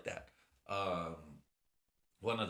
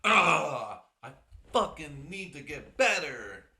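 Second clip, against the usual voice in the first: frustrated outburst; 5 semitones higher, 10 dB louder, slower than usual. Real, not acted. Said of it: "I'm a fucking bitch-ass baby".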